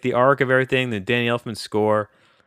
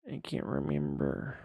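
softer, deflated repeat; defeated mutter; 5 semitones higher, 11 dB quieter than usual. Real, not acted. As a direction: let it fall away, giving up. Frustrated at forgetting the topic.

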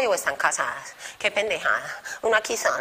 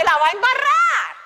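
exclaimed punchline; 11 semitones higher than usual. Real, not acted. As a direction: throw out the punchline with a burst. Punchline about people failing.

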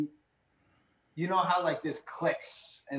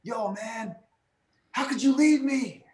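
high, louder mock complaint; imitating Tom Cruise; 8 semitones higher, 4 dB louder than usual. Retelling the movie's end, high indignant mock yell. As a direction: pitch it way up and push the last words. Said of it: "He's like".

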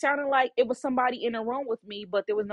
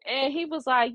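ordinary talk

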